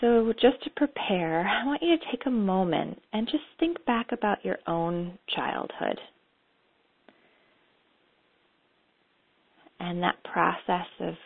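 calm, unhurried meditation guide; guided meditation. Acted. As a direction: lead them gently into memory.

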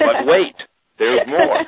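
big infomercial pitchman, way up high; announcer voice. Shown as a demonstration. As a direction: boom it out like a TV huckster. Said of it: "let me go back to my announcer's voice".

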